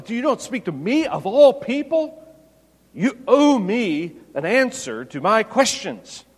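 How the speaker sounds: me hit high and loud, a mock outraged Pilate; emphatic me. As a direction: lean hard on me as if insulted. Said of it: "the me there is emphatic".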